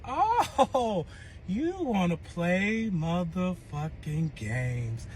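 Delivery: very slow, menacing retold comeback; drawn-out taunt. Woman recalls challenging her ex over leaked nudes, drawn-out taunt. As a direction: stretch every word out as a slow threat.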